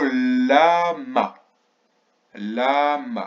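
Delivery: LAMA with long L and stretched first syllable; syllabes étirées. Shown as a demonstration. Teacher sounds out a word slowly. (« lama » étiré, syllabe par syllabe)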